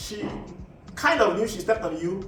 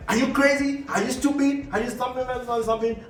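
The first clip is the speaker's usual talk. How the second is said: mock angry yelling, pitched higher; hammed-up retold quarrel in the market, higher voice; imitating a shout; 5 semitones higher than usual. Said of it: "and you shout".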